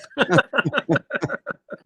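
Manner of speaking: bursting into a high laugh; real amused laugh; big laugh. Real, not acted. Laughs at own wild story.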